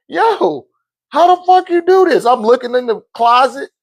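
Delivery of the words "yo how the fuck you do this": shocked shout. Retold or imitated. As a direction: blurt it out in disbelief. Father shocked at son.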